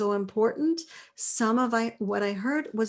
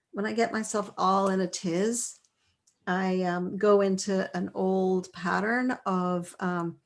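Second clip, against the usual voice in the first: ordinary delivery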